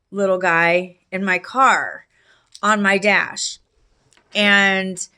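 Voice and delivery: ordinary talk, nothing marked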